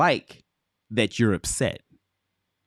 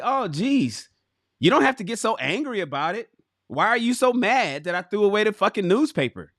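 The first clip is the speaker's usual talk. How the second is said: higher-pitched, mock-indignant partner; defensive comeback; 6 semitones higher than usual. Woman defending tossing the newspaper, mock defensive and high. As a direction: push back as if wrongly accused.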